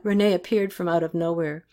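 usual speaking voice

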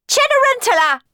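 very high, loud screeched summons. A narrator's reading. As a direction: screech for the servant from upstairs. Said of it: "screeched Clorinda and Tisby from upstairs".

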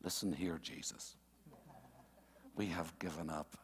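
a hint of a pushy disciple; Peter running his mouth. Preacher voicing Peter interrupting Jesus, lightly voiced and low. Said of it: "Peter runs off at the mouth".

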